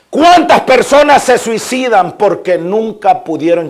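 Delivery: high, loud, emphatic pulpit delivery; preaching at full force. Real, not acted. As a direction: proclaim it forcefully to the whole congregation. (prédica encendida a viva voz)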